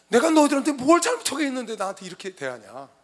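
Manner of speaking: preacher voicing an aggrieved God; God remonstrating. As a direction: plead your case indignantly to your own people. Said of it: "그게 하나님이 이스라엘 백성들과 변론하는 거예요".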